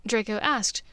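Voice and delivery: plain reading voice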